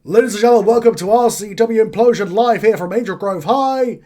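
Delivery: big, loud, high-pitched announcer welcome; ring announcer. Acted. Announcer welcoming the crowd at Angel Grove High, booming ring announcement. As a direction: belt out a wrestling show opening.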